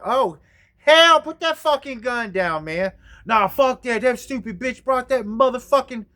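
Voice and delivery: loud furious back-and-forth; loud standoff argument; heated shouting match. Retold or imitated. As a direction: yell over each other in a rage. Heated retold armed standoff.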